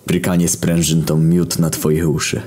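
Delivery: usual speaking voice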